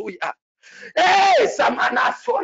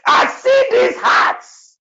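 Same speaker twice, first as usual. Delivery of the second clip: high, slow, drawn-out shout; 6 dB louder, much slower than usual. Real, not acted. Prophet crying out a vision to the church, slow high shout. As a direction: proclaim the vision at full force.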